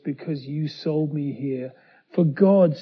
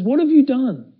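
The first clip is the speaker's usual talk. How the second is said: accusing cry; 8 semitones higher, 6 dB louder than usual. Acted. Steward catches brothers, accuses.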